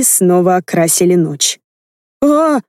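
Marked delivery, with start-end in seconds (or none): 2.2-2.6 sharp high yelp of pain; cry of pain. A narrator's reading. Heroine hit in the shoulder, sharp pained cry. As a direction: cry out as the shot hits. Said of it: "закричала я".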